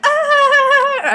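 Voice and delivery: high, loud excited scream; fangirl scream. Retold or imitated. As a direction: scream like you can't contain yourself. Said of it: "we were really loud".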